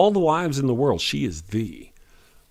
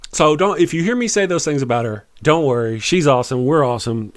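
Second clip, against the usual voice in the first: normal talking voice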